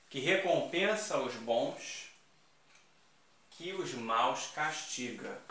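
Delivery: slow, weighty reading of the verse; slow recital. Shown as a demonstration. Teacher reciting the antithesis example slowly for the class. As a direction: lay out the inverted line slowly. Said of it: "aqui eu só vou inverter".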